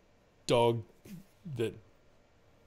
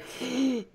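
dramatic gasp; 11 semitones higher, 4 dB quieter than usual. Retold or imitated. Retelling breaking down before dad.

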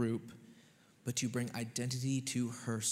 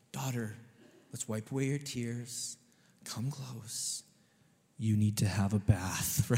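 gentle comfort; 3 semitones lower, slower than usual. Retold or imitated. Parent soothing crying daughter.

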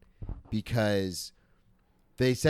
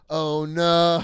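dawning horror; 3 semitones higher, 6 dB louder than usual. Retold or imitated. Realization retold again.

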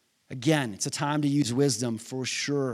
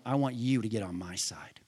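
whispered; 7 semitones lower, 6 dB quieter than usual. Acted. God's words, dropped to whisper.

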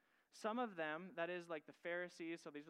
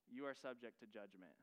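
softer and lower, a solemn warning; grave, hushed verdict; 7 semitones lower, 5 dB quieter than usual. Acted. Preacher voicing Jesus' warning to listeners, hushed and grave. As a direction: let the verdict sink in quietly.

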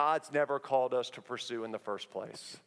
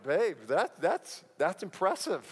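forced enthusiasm. Retold or imitated. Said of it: "really had to work on my enthusiasm".

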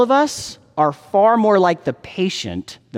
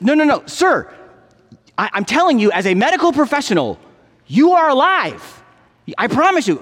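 higher-pitched, emphatic contradiction; insistent doctor; 8 semitones higher than usual. Acted. Doctor assures patient he is alive, insistent. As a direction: insist firmly, stretching the key claim. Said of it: "the doctor insists".

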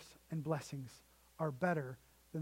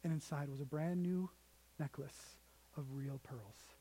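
ordinary talk, nothing marked

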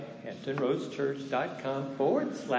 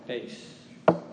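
whispered; 3 semitones lower, 5 dB quieter, slower than usual. Acted. Dramatic ending of sermon point.

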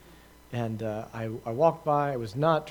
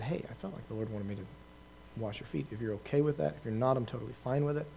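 softer, gentle words close to the ear; soft whisper; 8 dB quieter than usual. Retold or imitated. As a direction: murmur it privately to her. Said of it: "leaned and whispered in her ear".

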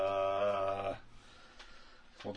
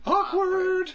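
very high, loud, drawn-out awkward; sarcastic awkward; 7 dB louder than usual. Retold or imitated. Telling friends about the movie scene, high loud squeal. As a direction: squeal it like a cringe. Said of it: "and then I just was like".

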